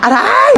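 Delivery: sharp high yelp; yelp of pain. Acted. Ghost struck by the homeowner, high yelp of pain. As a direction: cry out when struck.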